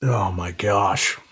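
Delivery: slow, soft, awestruck oh my gosh; awed hush. Real, not acted. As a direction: let it slip out slowly, hushed in awe. Tasting, awed by the whiskey.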